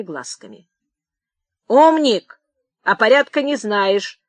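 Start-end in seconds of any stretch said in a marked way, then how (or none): 1.7-4.2 loud, high first word; angry shout. A narrator's reading. Clerk rebuking the old man, shouting. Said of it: "крикнул писарь".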